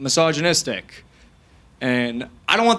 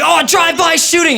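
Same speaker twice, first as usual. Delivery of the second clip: mock panic; 13 semitones higher, 4 dB louder than usual. Acted cry at a drive-by.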